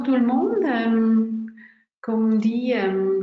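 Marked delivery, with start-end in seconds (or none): none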